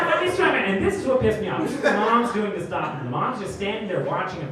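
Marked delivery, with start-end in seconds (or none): 0.0-0.7 put-on high childish boast; kid's boast. Comic imitating the boy punching people in line, high childish boast. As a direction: chant it up high like a proud little boy. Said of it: "He's like".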